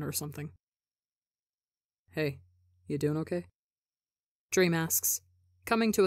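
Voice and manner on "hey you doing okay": soft, quiet, caring question; gentle concern. A narrator's reading. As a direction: ask softly, like a worried friend. Dream checks on Tubbo gently.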